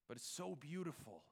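usual speaking voice

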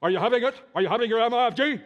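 imitating a loud, pushy professor; loud professor impression. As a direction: boom it out like the old prof calling you out. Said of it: "That's how he talked, very loud".

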